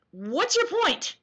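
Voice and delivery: usual speaking voice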